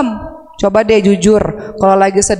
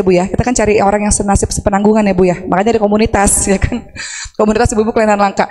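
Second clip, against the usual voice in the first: ordinary talk, nothing marked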